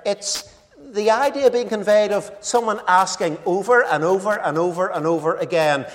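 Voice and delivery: normal talking voice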